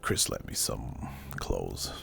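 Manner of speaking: ordinary delivery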